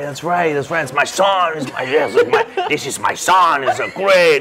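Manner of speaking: high, crowing imitation of dad; proud dad. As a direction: boast like a father celebrating his son, breaking into a laugh. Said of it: "My dad's like".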